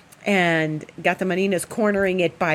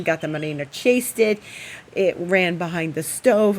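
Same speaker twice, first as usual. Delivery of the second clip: ordinary talk, nothing marked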